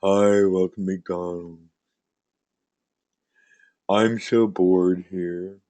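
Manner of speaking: bored worker. Acted. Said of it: "I want you to try working the front".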